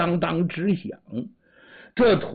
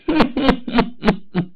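high, scornful villain's laugh; a bully's contemptuous cackle; cold sneering laugh; 9 semitones higher, 4 dB louder than usual. Acted. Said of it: "吴胖子冷笑一声".

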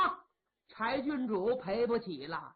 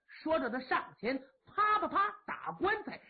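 usual speaking voice

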